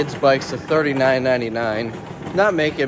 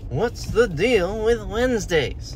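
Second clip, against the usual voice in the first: slow, high sing-song Seinfeld; lightly voiced Seinfeld observational bit; Jerry Seinfeld; 8 semitones higher, slower than usual. Shown as a demonstration. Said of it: "That's my Jerry Seinfeld impression".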